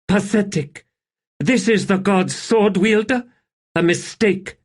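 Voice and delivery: high, contemptuous sneer; scornful. A narrator's reading. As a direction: spit it out with disdain. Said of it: "Sandalath snorted".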